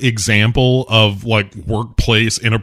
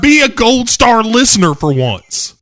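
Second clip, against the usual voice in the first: high, loud, fast playful reprimand; mock scolding; 11 semitones higher, 4 dB louder than usual. Host scolds cohost for interrupting, mock stern and loud. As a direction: scold the interrupting listener like a strict teacher.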